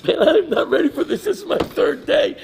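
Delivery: high, loud comic wail; mock blubbering. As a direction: wail it in an exaggerated, overwhelmed cry. Retelling his overwhelmed breakdown.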